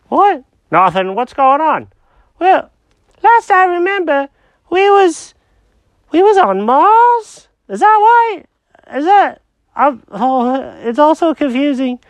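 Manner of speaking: high-pitched confused cat; squeaky cartoon cat, lost and puzzled. Jenkins voice continued.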